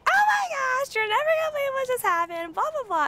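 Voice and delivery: very high-pitched retold squeal; imitating your own frantic phone shriek; excited mock scream. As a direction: squeal it like you're bursting with news. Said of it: "I was screaming in her ear".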